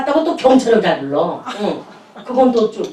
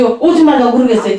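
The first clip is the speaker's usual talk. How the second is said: ordinary talk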